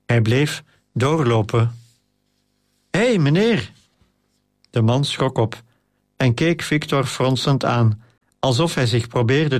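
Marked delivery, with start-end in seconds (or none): 2.9-3.7 higher shout over the noise; calling out. A narrator's reading. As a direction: call him back, higher. Calling man through the noise.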